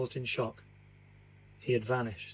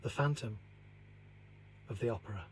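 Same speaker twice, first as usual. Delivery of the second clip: closing a spooky tale, the narrator softly intones the title; hushed dramatic title; 3 semitones lower, 10 dB quieter, faster than usual. A narrator's reading. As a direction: let the title hang in the dark, softly. Title read as dramatic close.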